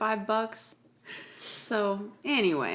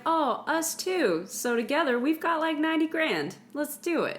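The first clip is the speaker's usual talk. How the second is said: high, excited mock movie dialogue; hammed-up team enthusiasm; acted eagerness; 8 semitones higher, 3 dB louder than usual. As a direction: pitch it up, eagerly joining the plan. Acted eager movie quote in retelling.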